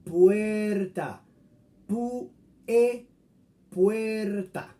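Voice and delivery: puerta broken into pu and e, then whole; diphthong breakdown. Shown as a demonstration. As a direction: split puerta into pu, e, then rejoin. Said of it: "Cada una de ellas mantiene su propio sonido". (puerta separada: pu, e, puerta)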